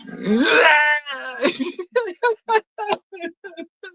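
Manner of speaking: hammed-up scare shriek; imitated scare scream. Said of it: "I love when he scares him here".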